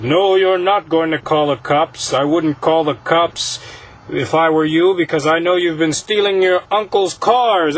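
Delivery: a put-on spooky voice leaning on the kid; menacing spirit threat.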